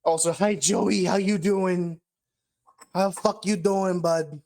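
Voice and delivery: excited greeting. Real, not acted. Greeting a friend in chat.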